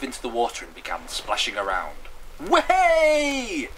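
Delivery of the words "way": high, loud, long whoop; joyful yell. Acted. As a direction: yell it out while splashing. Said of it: "she yelled".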